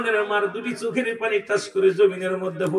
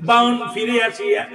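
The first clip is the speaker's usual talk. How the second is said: normal talking voice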